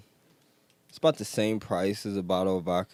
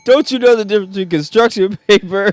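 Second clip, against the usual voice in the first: very high, very loud comic screech; outraged customer, pitched way up and blasting; mock irate customer; 13 semitones higher, 15 dB louder than usual. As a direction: shriek it like a hammed-up complaining shopper.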